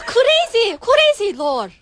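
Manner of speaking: hammed-up Singlish shriek; Singlish accent. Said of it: "we're gonna do a Singlish accent".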